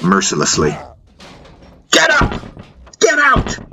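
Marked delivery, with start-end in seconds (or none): 1.9-3.6 very high-pitched shriek; furious shout. A narrator's reading. Aunt Nandi breaks up the brothers' fight, shouting. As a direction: shriek two sharp commands.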